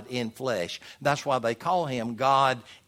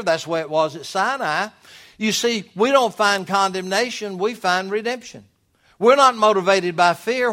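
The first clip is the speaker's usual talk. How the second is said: raised, higher, emphatic sermon voice; impassioned preaching; 5 semitones higher, 6 dB louder than usual. Real, not acted. Preacher tells church love motivates us, impassioned preaching. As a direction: preach it out with conviction.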